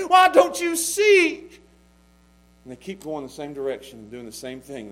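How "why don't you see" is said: high, loud, slow cry; preacher begging people who won't listen; exasperated plea. Retold or imitated. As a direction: throw up your hands and cry it out.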